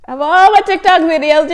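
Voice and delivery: excited aside about the coincidence; amazed. Real, not acted. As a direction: marvel at it. Marvelling at the coincidence.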